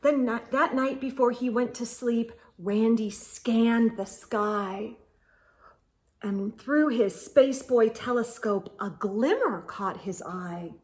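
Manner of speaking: usual speaking voice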